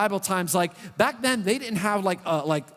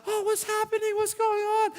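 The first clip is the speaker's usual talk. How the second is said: much higher, squeaky panicked bystander; other people voice. Retold or imitated. Pastor voicing worried moviegoers outside the fire alarm: high panicky squeak. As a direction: play a fretful onlooker in a high squeak. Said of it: "that's my other people voice".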